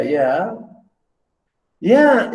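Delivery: usual speaking voice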